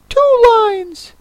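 very high-pitched creature cry. A narrator's reading. Mock Turtle corrects the Gryphon, crying out high. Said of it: "cried the Mock Turtle".

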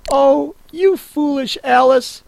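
put-on high girl's voice, mildly scolding; Alice scolding herself. A narrator's reading. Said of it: "she answered herself".

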